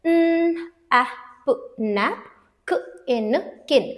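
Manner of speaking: "न, ऐप, नैप, क, इन, किन" with gaps, sound by sound; sounding out syllables. Shown as a demonstration. Teacher breaks napkin for learners, sounding out each syllable. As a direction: build "नैप" and "किन" from their separate sounds. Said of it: "Now let's break this".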